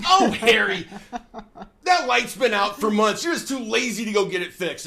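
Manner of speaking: put-on high, loud nagging old wife; mock nagging wife with a laugh.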